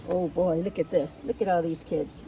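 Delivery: plain reading voice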